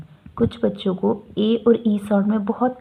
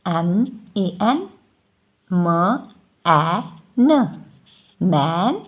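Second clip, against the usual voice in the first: slow sounding out; 3 semitones lower, much slower than usual. Shown as a demonstration. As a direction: spell "एम ए एन" slowly, then say "मैन". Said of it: "मैन के".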